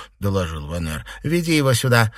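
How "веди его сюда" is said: raised, louder command; called out sharply. A narrator's reading. Mistress ordering a servant to fetch another, called out sharply. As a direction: order it out loud, pitched up. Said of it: "крикнула Фэнзе".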